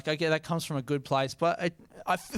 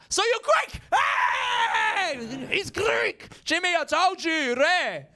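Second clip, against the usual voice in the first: shouting Greek dude; 14 semitones higher, much slower than usual. Retold or imitated. Greek dude shouting to Jimmy, mock high yell. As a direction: yell it out triumphantly, hammed up. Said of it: "They're like".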